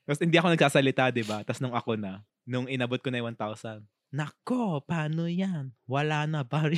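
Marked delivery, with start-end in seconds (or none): 4.1-6.8 imitating a heavily accented Tagalog speaker; retold line in a teasing accent; mock accent. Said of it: "Ba't may accent?".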